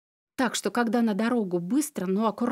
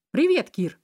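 higher, louder friendly hello; cheerful greeting; 5 semitones higher, 5 dB louder than usual. A narrator's reading. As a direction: greet the kid brightly. Greeting the boy warmly.

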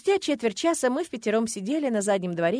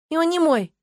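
буркнула; 9 semitones higher, 7 dB louder than usual. A narrator's reading. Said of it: "буркнула я".

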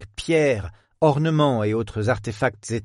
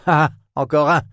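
louder, higher delighted approval; pleased approval; 5 semitones higher, 6 dB louder than usual. Acted. Professor approves the visitor's joke, loud and delighted. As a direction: cheer the joke like a pleased old scholar. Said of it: "approuva l'universitaire, visiblement satisfait".

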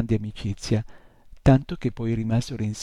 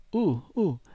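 a higher startled "uh, uh"; startled exclamation; 6 semitones higher than usual. A narrator's reading. Nightmare imp seeing the crowd of messengers, startled high hoot. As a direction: stop short and hoot in alarm. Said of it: "esclamò".

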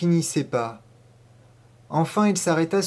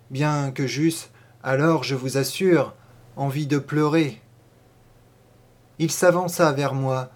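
usual speaking voice

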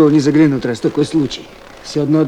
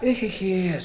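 sighing; 3 semitones higher, 8 dB quieter than usual. Acted. Detainee sighing over lost night.

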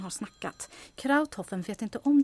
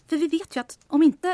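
1 building up to the punchline; 7 semitones higher, 8 dB louder than usual. Acted. Teasing buildup to a catchphrase.

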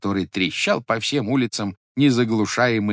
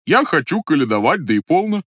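a higher put-on voice, stubborn; lightly pitched-up stranger, defiant; disguised voice; 6 semitones higher, 5 dB louder than usual. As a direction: insist in the fake caroler's voice. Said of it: "тем же голосом".

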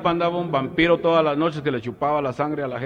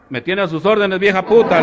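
mock shout; 8 semitones higher, 10 dB louder than usual. Punchline quote, told with a laugh.

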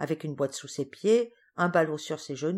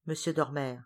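usual speaking voice

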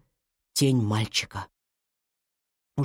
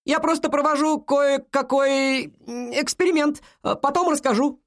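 high, halting excuse called downstairs; improvised explanation in a high, carrying voice; shouting back; 10 semitones higher than usual. A narrator's reading. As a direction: stall while calling across the house. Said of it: "крикнул он в ответ".

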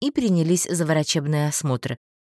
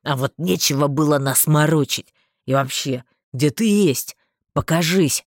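low-voiced annoyance; mildly angry man; angered; 5 semitones lower, 3 dB louder than usual. A narrator's reading. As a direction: challenge in a deeper male voice. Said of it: "обозлился Марстон".

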